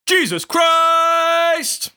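drawn-out booming Jesus Christ; loud dramatic reveal. Acted. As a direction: announce it like a grand unveiling. Big reveal of pick.

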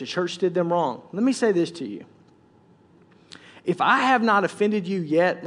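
ordinary talk

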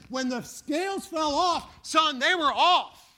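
slow and loud, shouted out; triumphant shout. Retold or imitated. Preacher voicing Saul to the church, triumphant slow shout. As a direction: proclaim it like a freed man, slowly.

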